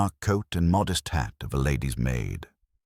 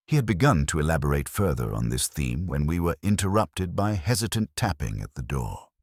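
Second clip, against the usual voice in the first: ordinary delivery, nothing marked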